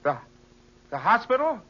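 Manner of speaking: the news hits you like a blow; shocked disbelief. Acted. Son learning his mother is in hospital, shocked.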